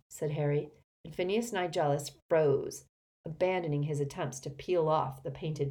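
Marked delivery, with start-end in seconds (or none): none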